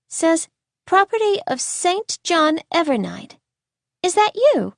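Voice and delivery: put-on high child's voice reading aloud; inquisitive little girl. A narrator's reading. Little girl reads the boy's book, curious and high. Young Layla reads his name.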